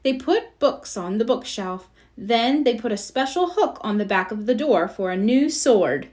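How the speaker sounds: usual speaking voice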